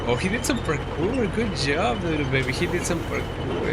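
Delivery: put-on high pet-praising baby voice; baby talk to cat. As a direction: gush over the kitten. I'm talking to the cat.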